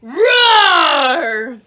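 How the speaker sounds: huge loud roar; bear roar. Shown as a demonstration. Reader roaring like Bruce for the children, loud roar. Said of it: "Can you roar like a bear?".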